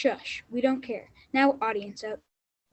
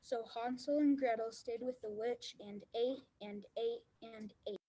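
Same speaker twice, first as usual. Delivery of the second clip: storybook narration fading; 11 dB quieter than usual. A narrator's reading. Narrator fades out.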